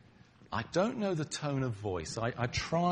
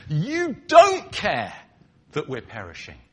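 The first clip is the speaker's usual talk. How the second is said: blunt accusation; 4 semitones higher, 12 dB louder than usual. Shown as a demonstration. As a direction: spell out the accusation plainly. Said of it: "That's what it's really saying".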